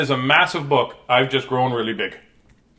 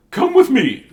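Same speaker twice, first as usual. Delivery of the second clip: high, loud teacher's summons; calling out; 11 semitones higher, 7 dB louder than usual. Acted. Teacher leading pupil into museum, calling out. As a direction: sing out an inviting call.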